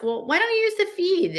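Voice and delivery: incredulous. Acted. Incredulous question.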